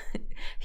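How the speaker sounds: short soft breathy chuckle; quick quiet laugh. A narrator's reading. Khaled amused by sulking Galila, soft chuckle. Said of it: "خالد كان بيضحك".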